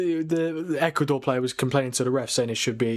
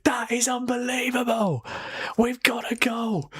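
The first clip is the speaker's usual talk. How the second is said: excited; 10 semitones higher than usual. Real, not acted. Late winner celebration.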